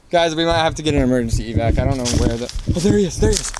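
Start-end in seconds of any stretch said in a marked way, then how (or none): none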